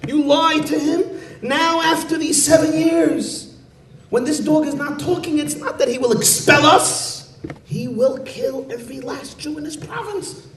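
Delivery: high, frantic scolding; rebuking. Acted. Community head rebuking Chaim Yankel, high frantic scolding. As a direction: berate him in panic. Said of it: "begins rebuking him and chastising him".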